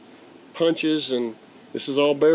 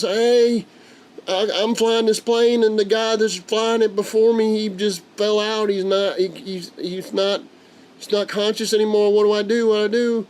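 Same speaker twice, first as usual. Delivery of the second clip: high, urgent retold panic into the headset; a scared amateur begging for help; frantic radio call; 8 semitones higher than usual. Grandpa radioing the tower that the pilot passed out, high urgent panic.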